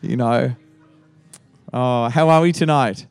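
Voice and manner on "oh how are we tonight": ordinary talk, nothing marked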